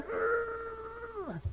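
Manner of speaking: high strained urgh of effort; mock the lamp straining hard; straining grunt. Preacher mocks a straining lamp, high strained grunt.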